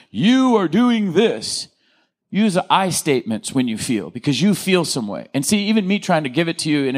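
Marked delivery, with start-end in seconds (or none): 0.1-1.7 slow, higher, pointed blame; accusing you-statement. Shown as a demonstration. Counselor demonstrating the wrong way to tell your spouse, slow accusing tone. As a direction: drag it out like an accusation, pitched up. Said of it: "instead of".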